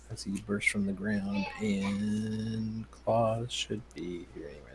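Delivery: usual speaking voice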